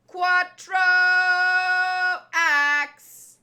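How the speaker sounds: a loud shouted attack call, Quattro axe; shouted attack name. Acted. Dubbing a swordsman's attack for fun, loud battle shout. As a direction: belt out the move name like an anime swordsman. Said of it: "I don't know why I'm doing that".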